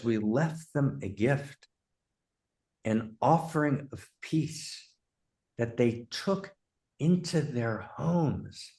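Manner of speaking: slow, hushed quote with weighty pauses; quiet, drawn-out film quotation; solemn recitation. Retold or imitated. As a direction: recite it softly, letting each phrase hang. Quoting the film's key line.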